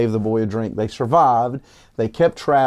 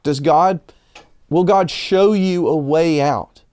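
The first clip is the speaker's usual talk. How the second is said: ordinary delivery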